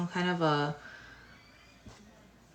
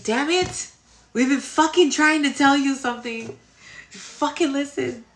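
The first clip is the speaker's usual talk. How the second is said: higher, louder, fed-up mock yell; exasperated spirit guides; 8 semitones higher, 6 dB louder than usual. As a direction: play the spirit guides losing patience. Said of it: "Must be how my spirit guides feel".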